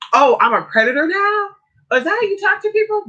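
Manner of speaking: high, mock-offended man's voice; mocking his indignation. Imitating the man on the chat line, a high mock-offended retort. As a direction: squeal it back like he's the victim.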